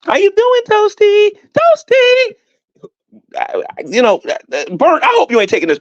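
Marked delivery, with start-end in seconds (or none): 0.0-2.3 high sing-song taunt; mock greeting. Greeting a rival YouTuber with a high mocking call. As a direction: call her name high and teasing.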